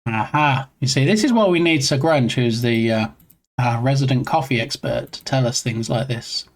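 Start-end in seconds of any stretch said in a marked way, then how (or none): none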